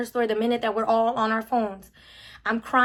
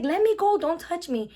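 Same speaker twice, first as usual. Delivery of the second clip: high, panicked "let me go"; retold frantic cry; 5 semitones higher than usual. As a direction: cry out in panic, reliving the grab. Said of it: "I'm like".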